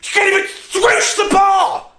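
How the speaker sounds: put-on bratty kid yelling insults at a teammate; furious kid. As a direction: sneer it like a bratty bowler. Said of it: "Scotty would be furious".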